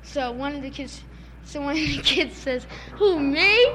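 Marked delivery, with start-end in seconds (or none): 3.0-3.7 higher, louder questioning squeak of innocence; feigned innocence. Retold or imitated. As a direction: play the caught kid, voice jumping up. Retold kid feigning innocence to counselor.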